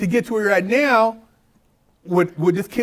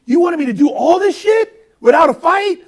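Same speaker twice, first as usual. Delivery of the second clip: mocking challenge; 5 semitones higher, 4 dB louder than usual. Retold taunting of attorney.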